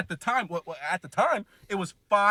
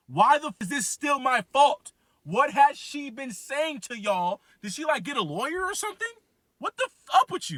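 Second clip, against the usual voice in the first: climbing higher and higher in disbelief; rising outrage; 8 semitones higher than usual. Retold or imitated. Confronting broker Nick over the damages, rising outrage. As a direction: pitch shoots up with each question.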